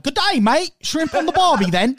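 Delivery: high, mock Australian accent put on thick. Mocking how [name] talks, in a hammed-up Australian accent. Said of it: "a thick Southern accent ... you hear her talk".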